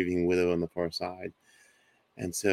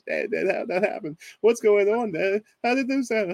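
put-on much higher, frantic child's plea, a bit louder; voicing a scared twelve-year-old begging for answers; panicked daughter; 14 semitones higher, 5 dB louder than usual. Father retells his daughter panicking at the stacked coins, much higher and frantic. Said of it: "just kind of freaking out at this point".

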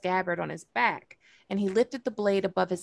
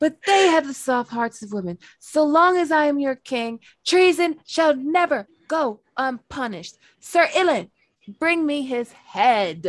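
roaring tyrant king; 6 semitones higher, 4 dB louder than usual. Retold or imitated. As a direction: bellow it like a spiteful boy king. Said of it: "That shit is burned in my brain".

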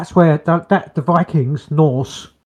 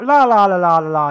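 high mock babble of la-las; imitated noisy chatter; 4 semitones higher, 3 dB louder than usual. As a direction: mimic loud relatives babbling away. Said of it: "they're like".

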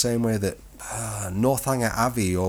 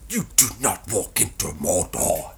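a light Yorkshire accent impression; Sean Bean Yorkshire accent; 3 semitones lower than usual. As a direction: do Sean Bean's Yorkshire accent, gravely serious. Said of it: "Sean Bean doing his very best accent".

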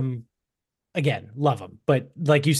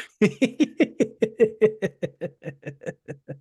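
high laughter; 9 semitones higher than usual. Real, not acted. Reacts to potato joke.

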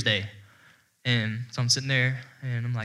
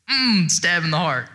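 louder, drawn-out, higher reaction; mock pained groan; 5 semitones higher, 6 dB louder, slower than usual. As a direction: groan it slowly, as if struck. Reliving an emotional blow.

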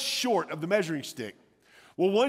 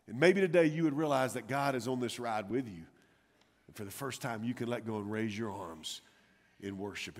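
tender appeal; 7 semitones lower, 7 dB quieter than usual. Real, not acted. Sermon appeal, tender close.

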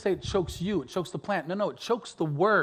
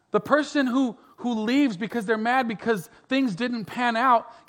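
raised, pitched-up pulpit voice; preaching at full force; 6 semitones higher, 4 dB louder than usual. Acted. Pastor urging the congregation, raised preaching voice. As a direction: drive it home to the congregation.

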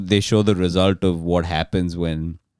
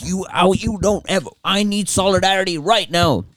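high, put-on voice demanding solidarity; mock indignant demand; 10 semitones higher than usual. Host demanding solidarity from a friend, high mocking voice. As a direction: lampoon a self-righteous friend. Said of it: "and he's like".

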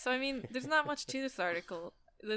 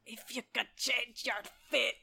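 mock Scottish accent; 2 semitones higher, 4 dB louder, slower than usual. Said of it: "that's a good one to do".

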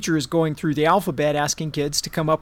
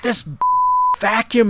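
angry outburst; 8 semitones higher, 5 dB louder than usual. Retold or imitated. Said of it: "And she was like".

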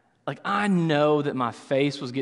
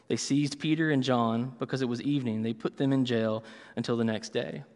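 lower, softer, measured reading of Acts; scripture reading; 3 semitones lower, 4 dB quieter than usual. Acted. Pastor reading Acts chapter four to the church, measured scripture reading. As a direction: read the verse steadily to the congregation.

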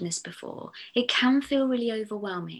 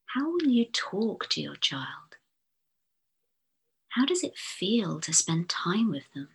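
guided meditation. Acted. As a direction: lull the listener gently into calm. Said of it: "using my posh hypnotherapist's voice".

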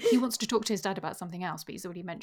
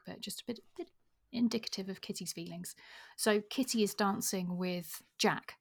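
normal talking voice

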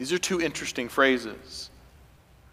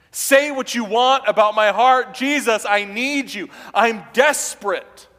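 loud, higher, pleading cry; desperate plea; 7 semitones higher, 6 dB louder than usual. Acted. Official begging Jesus to save his son, loud desperate plea. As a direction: beg as if a child's life depends on it. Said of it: "portrait of such desperation".

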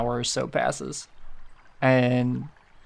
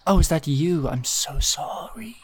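higher opening, then slow, sinking mock apology; thinly veiled sarcasm; 4 semitones higher than usual. Eliel apologising to Tedward for the book, sarcastic. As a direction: draw out the fake sorry, fading to breath. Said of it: "your thinly veiled sarcasm".

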